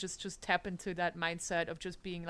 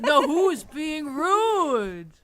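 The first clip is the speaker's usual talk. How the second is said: mock offended; 7 semitones higher, 10 dB louder, much slower than usual.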